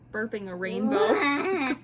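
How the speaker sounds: ordinary delivery, nothing marked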